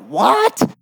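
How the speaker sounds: high, rising, incredulous yelp; startled disbelief. Acted. Teacher interrupted by a collapsing roof, startled. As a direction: react as if baffled mid-sentence. Reacting to the roof opening mid-lesson.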